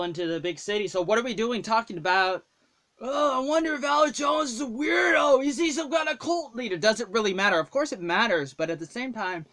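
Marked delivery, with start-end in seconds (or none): 3.0-6.8 high mocking whine, loud at first. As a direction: mimic the gossip in a silly high voice. Mocking people gossiping about Alex Jones.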